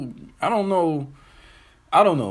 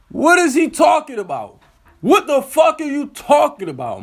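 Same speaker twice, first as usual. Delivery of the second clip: incredulous; 10 semitones higher, 3 dB louder than usual. Real, not acted. Incredulous at the singer.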